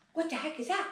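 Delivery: high-pitched, perky-eared curious question; perky curiosity. Acted. Horse trainer voices her interested breath, perky curiosity. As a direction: ask it high, like ears pricking up. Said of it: "That's my intonation in myself".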